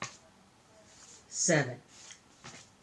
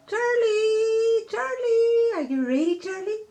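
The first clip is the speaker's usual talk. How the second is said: high, loud excited cry; excited shout; 7 dB louder, slower than usual. Real, not acted. Child shrieking the baby's name on the call, loud and high. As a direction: shriek his name with glee.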